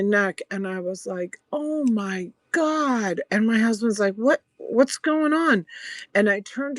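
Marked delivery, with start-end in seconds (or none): 1.5-3.2 high, drawn-out "oh my God"; stretched, pitched-up mock cry of pain; retold alarm. Retold sudden pain in neck. 4.2-5.6 high, slowed alarmed question; retold alarm, pitched well up; imitated startled husband.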